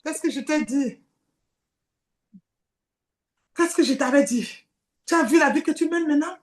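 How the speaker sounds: taunting Satan voice. Acted. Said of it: "Satan dit, c'est moi encore".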